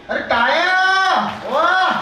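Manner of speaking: loud, high whoop of approval; cheering applause call. Real, not acted. Host cheers the groom's right bangle answer, loud delighted call. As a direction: cheer and rally the clapping.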